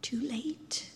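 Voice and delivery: whispered, voicing the doubting crowd; crowd muttering. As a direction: breathe it out like a murmur from the crowd. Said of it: "all those people in the crowd muttering now".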